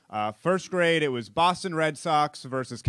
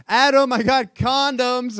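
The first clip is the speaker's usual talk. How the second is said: much higher, louder, slow yell across the store; yelling dad; 11 semitones higher, 8 dB louder, slower than usual. Retold or imitated. Dad yelling to his son across the pharmacy, loud. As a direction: call it out across the aisles. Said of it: "would yell from across the aisles".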